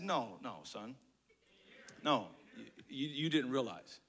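low, soft, halting; gentle correction. Acted. Dad correcting his son's small request, low and soft. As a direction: correct the child quietly, patiently.

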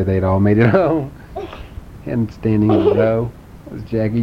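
plain reading voice

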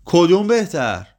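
loud, high-pitched challenge, words stretched; old woman shouting. A narrator's reading. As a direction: fling the question out loud and high. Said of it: "پیرزن داد زد".

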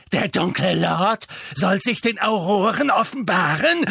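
high, shrill mad witch; wild unhinged ranting. Acted. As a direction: rant at him like a madwoman. Bellatrix mocks Harry madly.

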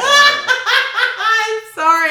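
tipsy, giggling apology. Real, not acted. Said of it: "I'm a little tipsy".

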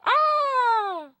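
sudden high squeal; startled yelp. Real, not acted. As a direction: yelp as the button goes. Selfie button pressed.

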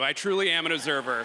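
usual speaking voice

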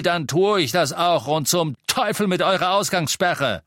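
loud, high indignant rant; angry defiance. A narrator's reading. Innkeeper defending his cat to Death Eaters, angry defiance. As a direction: argue back at full voice.

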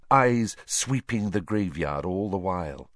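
usual speaking voice

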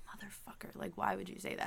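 retold reproach under the breath; hushed, mock-annoyed whisper; exasperated whisper. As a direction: whisper it like a private scolding. Annoyed aside in retold story.

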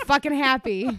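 loud, high angry cheer; angry happy. Real, not acted. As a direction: shout your happiness angrily. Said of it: "That's like an angry happy".

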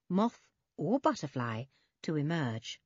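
ordinary delivery

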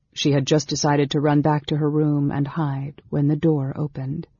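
ordinary delivery

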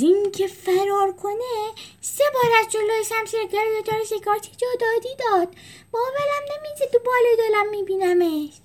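a nervous high prisoner telling gossip. Acted. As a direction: voice an awed fellow prisoner.

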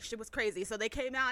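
usual speaking voice

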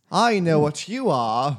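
slow, loud Scouse line from the show; Liverpool accent line. Acted. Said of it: "the line".

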